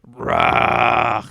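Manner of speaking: low, loud growling "Rah!"; game master voicing the attacking bear, a low loud roar. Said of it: "it's just like".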